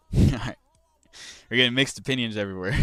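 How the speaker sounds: fast, laughing through the words. Real, not acted. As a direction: laugh it off while talking. Laughs reading chat comments.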